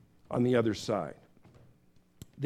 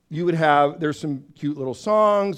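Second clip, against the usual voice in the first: normal talking voice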